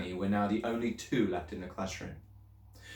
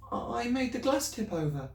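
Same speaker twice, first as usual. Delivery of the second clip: put-on higher, anxious little girl's voice; girl pleading with her teacher, higher and anxious; young Matilda. A narrator's reading. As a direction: speak up as a worried schoolgirl.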